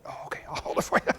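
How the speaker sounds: hushed, embarrassed whisper; whispered reply. Retold or imitated. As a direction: breathe it out as a whisper. Mother answering preacher's wife.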